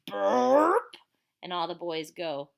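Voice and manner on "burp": mock burp. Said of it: "then she goes".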